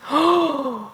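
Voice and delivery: sharp high gasp of delight; excited gasp. Real, not acted. Thrilled by the character lineup.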